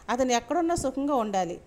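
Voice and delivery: plain reading voice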